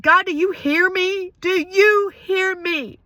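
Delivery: very high, loud anguished scream, the last words drawn out slowly; screaming at God. Retold or imitated. Wife reenacting screaming at God beside her husband, high anguished scream. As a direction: scream the question up at God in despair. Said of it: "I'm screaming at God".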